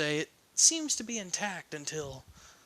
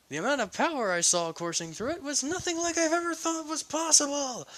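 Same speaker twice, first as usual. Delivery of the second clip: high, excited mad-scientist voice, rising higher and faster at the end; a thrilled lunatic marveling at his own machine; giddy mad scientist; 4 semitones higher than usual. Acted. Mad scientist raving to Dynamite, high and giddy.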